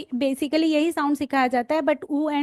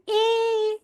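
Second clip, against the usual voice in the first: slow and higher, each letter sung out; letter name, drawn out; 7 semitones higher than usual. Shown as a demonstration. Teacher showing trainees letter names, slow and high. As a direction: model the letter name for children. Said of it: "ऐसे बोलते हैं ना".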